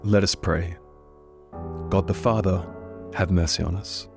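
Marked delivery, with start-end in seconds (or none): none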